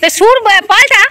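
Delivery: a loud laughing shout to the crier; loud call. Real, not acted. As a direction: call out across the yard, full voice. Friend calls out during farewell.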